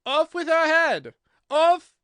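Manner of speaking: very loud, high, drawn-out shriek; furious scream. A narrator's reading. Queen ordering Alice's execution, furious high scream. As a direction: scream the order in a crimson fury.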